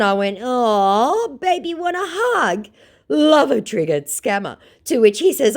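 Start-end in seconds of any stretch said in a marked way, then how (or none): 0.4-2.7 high cooing tease; sarcastic baby-voice sympathy; mock baby talk. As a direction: coo at him like a toddler. Mocking scammer.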